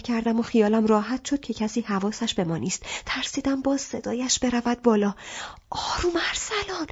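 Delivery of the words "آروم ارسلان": high, breathy plea to calm down; whispering to Arsalan to calm him at the office; urgent whisper. Acted. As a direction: hush him so nobody hears. She hushes him, fearing a scene.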